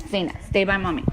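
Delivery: ordinary talk, nothing marked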